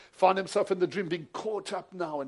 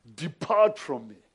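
slow, solemn divine voice, a touch louder; voice of judgment; 7 dB louder, slower than usual. Retold or imitated. Dreaming preacher voicing God's sacred judgment, slow and solemn. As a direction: intone it like a judge from the throne. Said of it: "holy presence saying".

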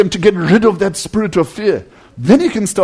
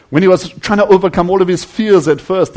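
ordinary talk, nothing marked

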